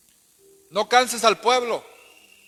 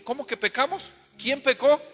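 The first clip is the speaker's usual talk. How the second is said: much higher, alarmed ¿Quién pecó?; shocked question; 7 semitones higher than usual. Acted. Joshua asking God who sinned, high and alarmed. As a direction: jump up in disbelief.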